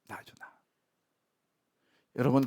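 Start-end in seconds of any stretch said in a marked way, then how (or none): 0.1-0.5 a hushed admission it's me; whispered confession. Real, not acted. Pastor admits to the congregation he's the fool, whispered. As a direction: whisper it, owning the blame. Answering own question.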